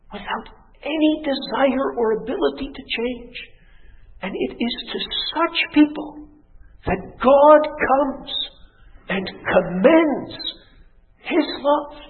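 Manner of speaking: high, drawn-out pulpit climax; slow, fervent proclamation; impassioned preaching. Acted. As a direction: stretch each phrase with awed emphasis.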